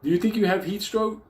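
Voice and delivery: high, mock dumb paramedic voice. Paramedic asking the collapsed men a dumb question, high mocking voice. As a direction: play the clueless paramedic up high. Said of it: "You ask one question".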